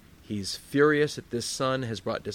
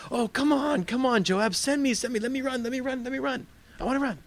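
high-pitched, rapid pleading; eager begging; 12 semitones higher than usual. Acted. Messenger begs Joab to let him run, high eager pleading. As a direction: beg like an overeager kid, voice way up.

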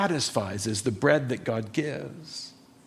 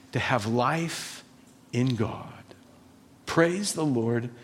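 soft reverent praise; 3 semitones lower, 7 dB quieter than usual. Real, not acted.

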